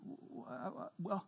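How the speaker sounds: halting, soft stammer; acted hesitant client, faltering over the first words; flustered stammer. Client answering a counselor who just yelled, flustered.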